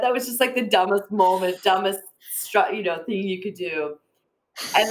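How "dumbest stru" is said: ordinary talk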